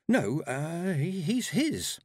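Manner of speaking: put-on high, hesitant girl's voice; flustered young girl. A narrator's reading. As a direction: stammer it out nervously up high. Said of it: "Death asked Tiffany".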